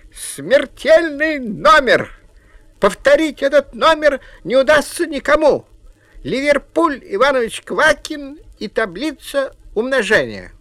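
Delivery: loud, high ringmaster announcement; booming circus ringmaster. Acted. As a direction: announce the deadly stunt to the crowd. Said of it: "объявил".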